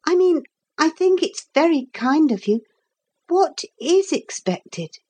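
put-on high boyish voice, politely eager. Boy thanking the carpenter and asking his task, politely eager. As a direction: pitch it up, asking courteously.

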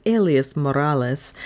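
ordinary delivery, nothing marked

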